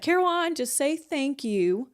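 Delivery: high "Caroline", then a slow "just say thank you"; lightly voiced aunt coaxing her; aunt's gentle scolding. The aunt telling Caroline to accept praise, coaxing slowly. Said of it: "she's like".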